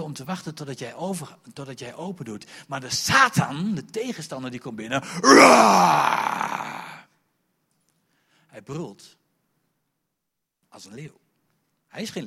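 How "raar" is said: loud high mock roar; preacher's mock lion roar. Satan roaring like lion.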